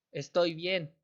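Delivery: ordinary talk, nothing marked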